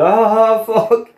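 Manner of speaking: high startled groan of fuck; sudden high cry just before the train hits; mock dismay. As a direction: caught out, yelping ah fuck. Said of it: "you just see him go".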